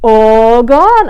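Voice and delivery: trumpeting. A narrator's reading. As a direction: blare it like an elephant. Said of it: "I trumpet".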